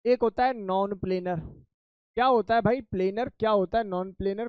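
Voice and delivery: ordinary talk, nothing marked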